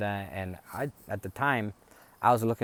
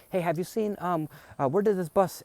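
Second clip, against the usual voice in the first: loud calling out; 10 semitones higher, 4 dB louder than usual. Retold or imitated. Relatives calling out searching.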